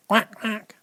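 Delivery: high quacking duck voice. Shown as a demonstration. Reader showing a child how a duck goes, high quack. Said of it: "That's the sound a duck makes".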